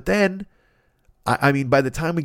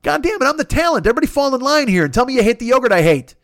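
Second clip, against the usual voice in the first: much higher, indignant mock tantrum; high indignant talent rant; outraged diva; 9 semitones higher, 4 dB louder than usual. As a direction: throw a hammed-up star tantrum. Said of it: "And I'm like".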